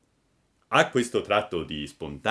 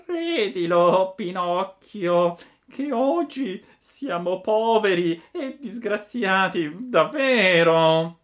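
high, slow begging whine of a sly fox; whining fox; 11 semitones higher, 8 dB louder, slower than usual. A narrator's reading. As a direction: beg pitifully, drawing out every word. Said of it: "con voce di piagnisteo".

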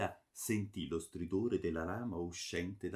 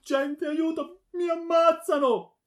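very high, loud shriek; screaming for help; 12 dB louder than usual. A narrator's reading. Daughter fleeing the sword, screaming for help. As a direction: shriek in mortal terror. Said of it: "ed urlava".

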